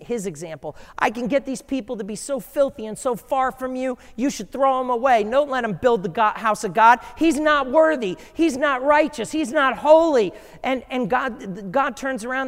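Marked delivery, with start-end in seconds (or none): 1.0-10.3 accusing devil. Acted. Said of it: "The devil's trying to use the priest".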